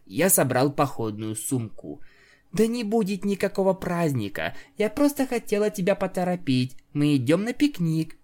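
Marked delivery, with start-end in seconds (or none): 2.5-8.1 female pony voice. Acted. Octavia voiced by narrator.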